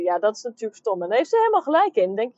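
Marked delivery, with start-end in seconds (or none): 1.0-2.1 excited agreement. Real, not acted. Agrees eagerly with the customer.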